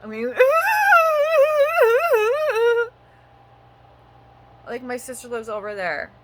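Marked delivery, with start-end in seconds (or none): 0.3-2.9 high, loud pained laugh; exasperated laugh. Real, not acted. Ranting about her situation, high pained laugh. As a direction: laugh in disbelief at it all.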